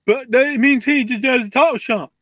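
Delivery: high indignant whiny fan. Retold or imitated. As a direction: whine it like an entitled fan. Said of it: "and then they'll go".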